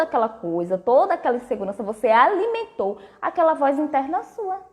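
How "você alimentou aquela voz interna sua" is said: ordinary talk, nothing marked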